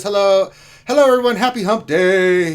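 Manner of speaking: loud, high, booming hello; hyped shouted greeting. Acted. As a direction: holler the greeting high and drag the ending. Hyped reading of chat greeting.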